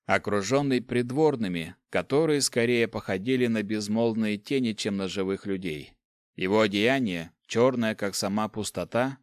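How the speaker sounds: ordinary delivery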